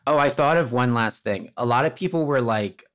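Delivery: ordinary talk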